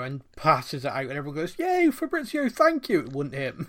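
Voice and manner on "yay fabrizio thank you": high-pitched fake gratitude; hammed-up, high excited thanks; mock cheering. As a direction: cheer like a gushing fan, sarcastically. Mimics fans cheering the journalist.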